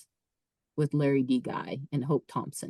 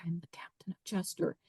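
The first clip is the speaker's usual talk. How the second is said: unvoiced whisper, words to himself; whispered to myself; 12 dB quieter than usual. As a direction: whisper the mantra under your breath. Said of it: "I'm saying it to myself".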